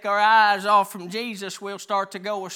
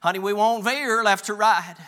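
ordinary delivery

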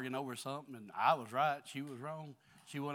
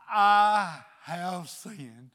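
loud, very slow, drawn-out cry; David crying out his sin; David's cry of repentance; 5 semitones higher, 15 dB louder, much slower than usual. Acted. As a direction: wail it out stretched and loud. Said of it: "He said".

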